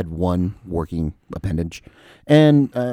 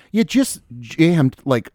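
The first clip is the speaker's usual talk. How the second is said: indignant retort; 6 semitones higher, slower than usual. Retold or imitated. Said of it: "And I'm like".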